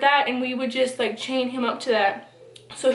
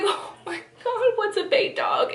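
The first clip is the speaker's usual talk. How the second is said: shocked; 9 semitones higher than usual. Retold or imitated. Hearing about dog fights.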